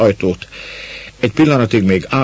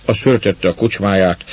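ordinary delivery

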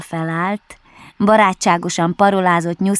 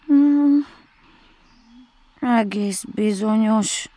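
slow, hesitant confirmation after a long hum; pondering; 4 semitones higher, slower than usual. A narrator's reading. Bear assuring Rabbit who he is, slow and pondering. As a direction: hum in thought, then answer slowly.

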